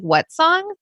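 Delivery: high, baffled asking; puzzled question. Retold or imitated. Sister at the wedding not knowing the song, baffled. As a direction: act totally lost. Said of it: "my sister's like".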